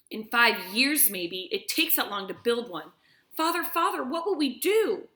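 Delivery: a hint of a scared daughter pleading with her father; lightly voiced frightened girl.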